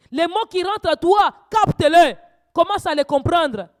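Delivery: loud, high fervent preaching; preaching at full force. Acted. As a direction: rouse the congregation, voice climbing. Preacher exhorts congregation to pray.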